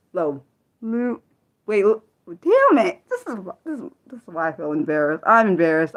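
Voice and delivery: usual speaking voice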